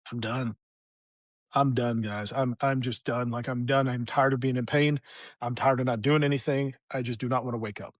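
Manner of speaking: low, worn-out voice of giving up; understated despair, dropped in pitch; retold weary resignation. Man in pain looking at pills, retold weary resignation.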